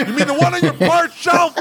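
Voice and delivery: very high, exasperated yell; mock yelling. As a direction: yell sarcastically at a hapless technician. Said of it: "That's yelling".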